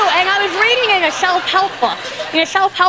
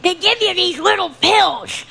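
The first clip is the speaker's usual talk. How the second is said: ordinary talk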